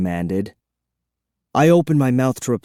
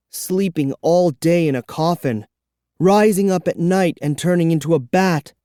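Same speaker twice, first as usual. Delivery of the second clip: usual speaking voice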